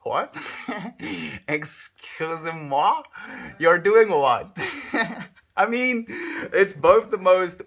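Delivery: high and loud, laughing in disbelief; stunned laughing outburst; shocked disbelief. Real, not acted. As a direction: react like you can't believe it, mock French. Shocked by brain-grown eyes.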